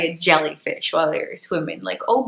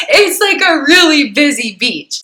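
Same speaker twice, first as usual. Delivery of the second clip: louder, higher, stressing busy beach; emphatic repeat; 6 semitones higher, 7 dB louder than usual. Real, not acted. Repeating to listeners how crowded the beach is, excited and louder. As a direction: punch it up with amusement.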